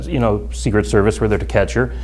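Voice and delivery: normal talking voice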